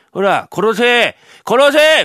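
high, loud, building yell; shouting kill me. Acted. Man begs thief to kill him. (声を張り上げて「殺せ」と叫ぶ)

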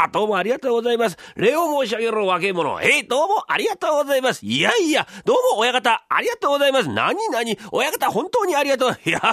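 bow and gush like eager apprentices; young apprentices. Pretend. Apprentices thank the master for the tip, high and eager.

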